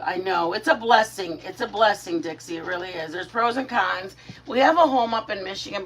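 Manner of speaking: ordinary delivery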